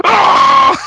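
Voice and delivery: loud, high-pitched hammed-up scream; exaggerated shriek; mock scream. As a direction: scream at the top of your lungs, for comedy. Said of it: "Just screaming at the top of his lungs".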